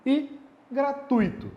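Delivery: ordinary talk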